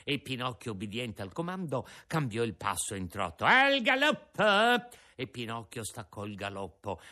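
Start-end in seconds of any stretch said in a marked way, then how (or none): 3.5-5.0 slow, high, loud call to gallop; shouted command. Acted. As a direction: bellow it to the ring. Ringmaster commands donkey.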